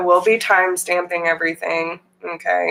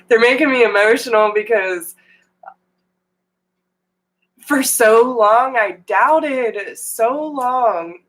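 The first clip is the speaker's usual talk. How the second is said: getting emotional; 4 semitones higher than usual. Real, not acted.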